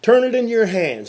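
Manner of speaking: slow, loud locker-room opener; coach's speech. Retold or imitated. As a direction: bark it to the team like Lombardi. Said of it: "And the speech started".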